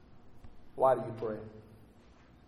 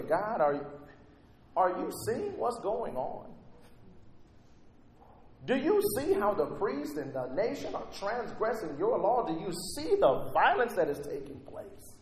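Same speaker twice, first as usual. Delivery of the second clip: high-pitched, pleading questions to God; swooping, exasperated prayer; frustrated Habakkuk; 4 semitones higher than usual. Acted. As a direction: press God for answers, incredulous. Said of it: "He's frustrated".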